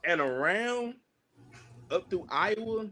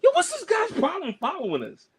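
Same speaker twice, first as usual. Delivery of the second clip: irritated callout; 13 semitones higher, 3 dB louder than usual. Retold or imitated. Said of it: "he just kinda, like, stops and he goes".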